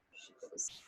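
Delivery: soft aside whisper. Acted. Narrator whispering between story lines. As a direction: whisper it to the kids.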